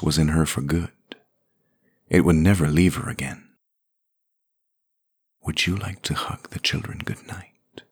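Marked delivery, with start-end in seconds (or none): 5.4-7.8 soft unvoiced whisper. A narrator's reading. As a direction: breathe it gently. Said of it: "Moth whispered".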